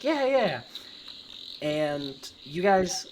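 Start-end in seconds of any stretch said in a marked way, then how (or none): 0.0-0.6 high kids' chorus, voiced alone; eager children. Children agreeing to a game of tag, high and eager. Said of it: "all of them are like".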